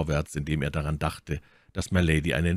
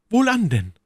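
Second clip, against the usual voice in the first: high, louder resolved cry; a sudden resolute call, much higher; exclaiming; 6 dB louder than usual. A narrator's reading. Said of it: "rief My Lady". (My Lady ruft entschlossen, hoch und laut)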